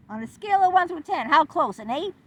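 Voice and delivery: mock him pressing for a number; mocking loud quote. Therapist's question mocked.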